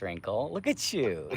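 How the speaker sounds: ordinary talk, nothing marked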